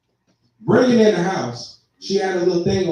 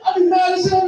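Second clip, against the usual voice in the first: mock shout; 11 semitones higher than usual. Real, not acted. Shouting anger.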